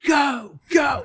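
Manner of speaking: a high, shouted plea to keep playing; crowd yelling. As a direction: holler it like the audience. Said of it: "everybody's saying".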